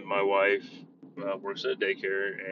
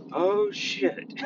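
higher, sudden oh shit; mock realization; 11 semitones higher, 3 dB louder than usual. Kid imitated realizing he messed up, higher. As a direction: play the kid's dawning horror.

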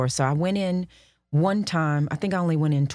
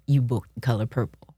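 hushed; 6 semitones lower than usual. Retold or imitated. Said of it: "manager said".

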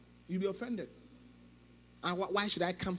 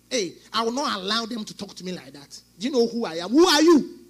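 indignant churchgoer; 8 dB louder than usual. Acted. Offended churchgoer ranting, acted.

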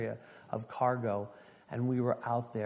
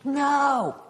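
loud, very high cry of shock; shocked disbelief; 14 semitones higher, 7 dB louder than usual. Retold or imitated. Class hears the chief is dead, shocked.

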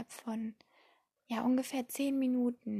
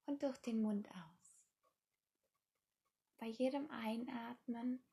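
guided meditation; 8 dB quieter than usual. Acted. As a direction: ease the listener into calm, softly.

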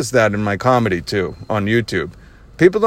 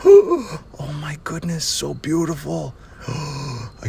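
gasping, slow whisper of wonder; hushed awe; 5 semitones higher, 3 dB quieter, much slower than usual. Real, not acted. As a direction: whisper it slowly, breath taken away by the sight. Awestruck at seeing the fox.

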